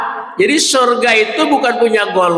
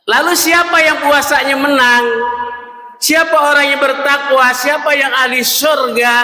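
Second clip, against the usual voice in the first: rousing preaching; 6 semitones higher than usual. Real, not acted. Preacher rouses the congregation with questions, raised calling voice. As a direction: call the questions out to the whole room.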